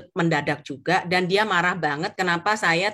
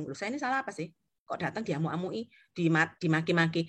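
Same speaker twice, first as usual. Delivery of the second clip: soft, confused wondering to oneself; hushed, puzzled self-questioning; bewildered; 3 semitones lower, 7 dB quieter than usual. Retold or imitated. Agent wondering why the client rages at her, bewildered. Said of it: "Terus saya bingung".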